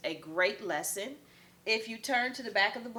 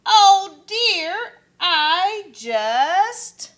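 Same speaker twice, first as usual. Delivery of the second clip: loud high wail of oh dear; panicked; 9 semitones higher, 12 dB louder, much slower than usual. Acted. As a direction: cry out in panic. Kevin panicking as tree falls.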